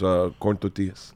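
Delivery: put-on low, soft Mexican-accented "tortillas". Friend ordering corn tortillas from the lady, Mexican accent. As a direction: say "tortillas" with a Mexican Spanish pronunciation. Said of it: "With an accent".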